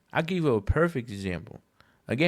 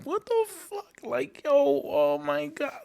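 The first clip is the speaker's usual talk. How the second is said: much higher, slow incredulous cry; stunned disbelief. Retold or imitated. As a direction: let the shock ring out. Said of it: "I'm like".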